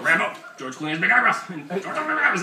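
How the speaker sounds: loud, high nonsense reprimand; mock dog scolding. Shown as a demonstration. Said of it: "So you go".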